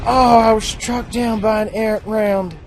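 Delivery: mock dramatic lament.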